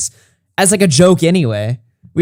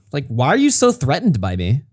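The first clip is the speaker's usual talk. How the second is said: usual speaking voice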